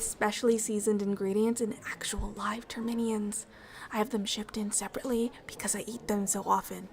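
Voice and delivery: hushed, unvoiced confiding whisper. Ambassador describing her stew to the human, whispered. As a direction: let the detail slip out in a whisper.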